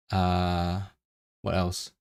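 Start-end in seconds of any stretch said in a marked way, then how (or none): none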